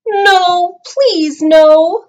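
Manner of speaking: put-on high loud begging kids; high child voices pleading loudly; pleading children. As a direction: beg like upset children. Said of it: "cried the children".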